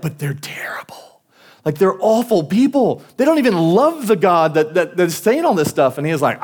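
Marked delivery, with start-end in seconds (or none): 0.0-1.2 hushed, whispery mock dismay; retold dismayed protest. Balak objects to blessing terrible people, hushed mock dismay. 1.6-6.0 higher-pitched mock indignation; retold exasperated protest. Balak protests to Balaam about awful people, mock exasperation.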